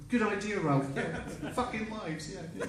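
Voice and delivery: small, high, soft placating; cowed agreement. Retold or imitated. Singer agreeing with angry Ralph, meek and high. Cowed reply after the shouting.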